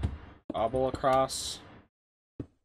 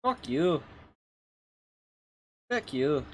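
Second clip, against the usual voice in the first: short, higher mock Scottish retort; Scottish accent; 4 semitones higher, slower than usual. Game streamer trying out the impression on an insult, in a Scottish accent. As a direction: try a curt Scottish put-down. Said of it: "Doing a Scottish accent".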